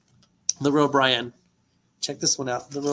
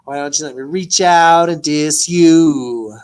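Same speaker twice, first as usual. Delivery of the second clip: quoted hook; 9 dB louder than usual. Retold or imitated. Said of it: "I said".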